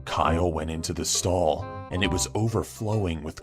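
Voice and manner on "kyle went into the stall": plain reading voice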